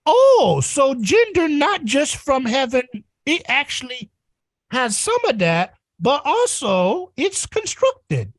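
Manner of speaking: put-on slow, wondering toddler voice; toddler figuring it out. Humanity as a toddler puzzling out gender, slow toddler voice. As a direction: play a toddler making a big discovery. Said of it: "human species is like a toddler".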